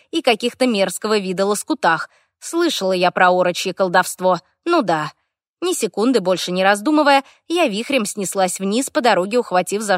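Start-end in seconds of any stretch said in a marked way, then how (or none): none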